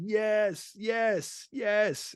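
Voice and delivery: high, held-back cheer; mock celebration. Friends celebrating getting across the border, mock high-pitched cheer.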